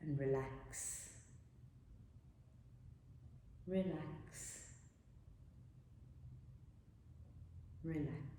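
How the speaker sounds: soft, slow, sinking relax; soothing hush. Acted. Teacher lulling the class to rest, fading hush.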